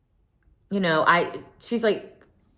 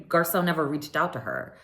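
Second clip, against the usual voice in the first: ordinary delivery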